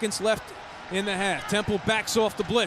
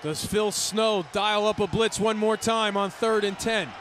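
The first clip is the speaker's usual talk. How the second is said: ordinary talk, nothing marked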